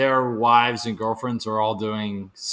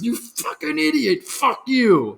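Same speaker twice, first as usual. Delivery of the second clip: raging at phone; 10 semitones higher than usual. Retold or imitated. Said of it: "that's where he goes".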